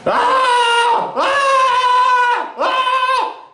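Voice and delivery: put-on very high shrieking "Ah!" like screaming kids; kids' high-pitched scream. Imitating park kids screaming way up high. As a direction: shriek high like a kid on a bike. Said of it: "kids on their bikes just screaming at the top of their lungs".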